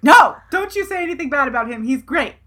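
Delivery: high, loud "No!" then quick scolding; mock-outraged protest; acted indignant defence.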